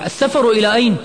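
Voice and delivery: very high, loud cry of alarm; shouting. A narrator's reading. Imad leaps up at travel news, shouting. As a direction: shout the question in disbelief. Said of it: "نهض عماد من مكانه وهو يصيح".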